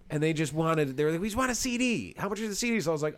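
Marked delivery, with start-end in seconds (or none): 1.2-2.8 high mock fan voice, asking the price; imitating the couple. Couple at the gig asking for a CD, high mock voice. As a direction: play the eager fan lightly.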